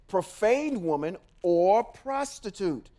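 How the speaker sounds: ordinary delivery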